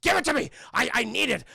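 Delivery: hammed-up raging demand, high and straining; mad, aggressive. Sick old man demands the hero's youth, hammed-up aggressive. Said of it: "he gets mad. He gets aggressive".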